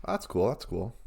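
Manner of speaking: low, halting cool-guy voice; put-on cool voice. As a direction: drop your voice to sound cool. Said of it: "I'm trying to play it cool".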